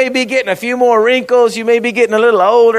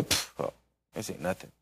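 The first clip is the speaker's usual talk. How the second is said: breathy unbothered whisper, softer; shrugging it off; 13 semitones lower, 18 dB quieter than usual. Pretend. Preacher imagines a future trial, shrugging it off. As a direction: brush the trouble off with a scoff. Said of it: "I say".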